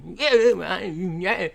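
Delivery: put-on high, nasal-ish mumbled Venom accent; Eddie Brock impression. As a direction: do Tom Hardy's Eddie Brock. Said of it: "that's my Eddie Brock".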